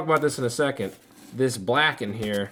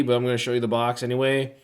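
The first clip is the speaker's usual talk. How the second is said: ordinary talk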